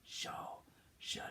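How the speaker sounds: a breathless whisper of Shosholoza; whispered echo. Shown as a demonstration. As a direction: whisper it as a pupil's reply. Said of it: "You say it".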